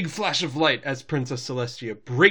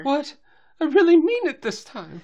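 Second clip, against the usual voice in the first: high, loud indignant protest; Rarity; 13 semitones higher, 6 dB louder than usual. Retold or imitated. Drama queen answering everyone's stares, high indignant protest. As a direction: pitch it way up, mock-offended.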